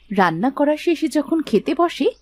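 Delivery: usual speaking voice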